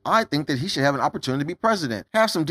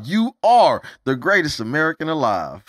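normal talking voice

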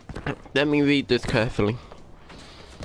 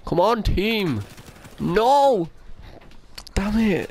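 frustrated shouting; 9 semitones higher, slower than usual. Real, not acted. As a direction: yell at the team in frustration. Losing game, frustrated outbursts.

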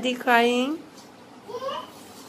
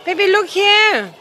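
calling out; 5 semitones higher, 6 dB louder than usual. Real, not acted. Father calling the baby to look at the camera, louder.

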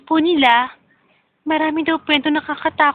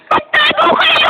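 high, slow call to hide; urgent call; 10 semitones higher, 4 dB louder, slower than usual. Acted. Warning everyone to hide.

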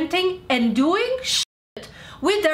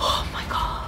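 breathy unvoiced exasperation; whispered oh my god; 4 dB quieter than usual. Real, not acted. Fed-up creator whispering in disbelief to viewers. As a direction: let it out under your breath.

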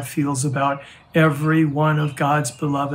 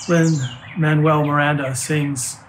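ordinary delivery

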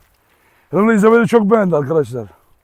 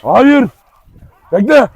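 loud command; 5 semitones higher, 7 dB louder, much slower than usual. Real, not acted. Stopping dog from attacking.

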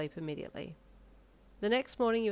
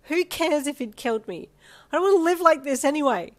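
high, loud desperate scream through tears; 9 semitones higher, 12 dB louder than usual. A narrator's reading. Screaming at Darby in hospital, high and loud in despair. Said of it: "you screamed".

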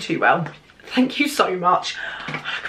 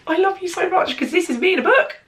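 gushing; 10 semitones higher than usual. Real, not acted. Gushing over a gift box.

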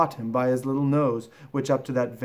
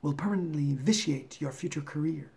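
softer, pompous serpent; python rock snake; 3 semitones higher, 4 dB quieter than usual. Acted. Python warning of the crocodile's pull, pompous and snaky. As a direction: give a stately snake's warning. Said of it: "That is the way all bicolored python rock snakes always talk".

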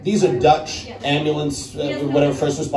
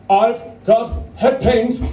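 slow, higher-pitched halting complaint; mock ailing man; 5 semitones higher, slower than usual. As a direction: imitate a man struggling to report his ailment. Acting out Gary's ailing complaint.

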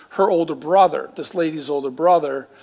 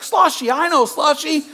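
much higher, laughing, a different voice; excited recognition; 11 semitones higher, 4 dB louder than usual. Retold or imitated. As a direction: voice the brother's gleeful surprise. Brother recognizes mutual friend.

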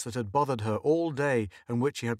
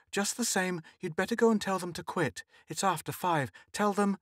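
anxious; 7 semitones higher than usual. A narrator's reading. Anxious wife urges husband.

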